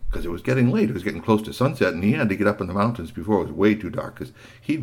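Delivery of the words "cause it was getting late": usual speaking voice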